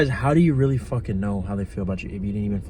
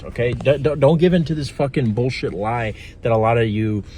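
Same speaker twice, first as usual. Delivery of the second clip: usual speaking voice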